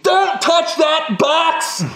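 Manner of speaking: mock shout. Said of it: "And he's like".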